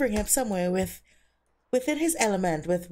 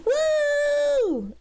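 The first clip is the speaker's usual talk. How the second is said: excited cheer; 5 dB louder than usual. Real, not acted. Cheering her Sims on.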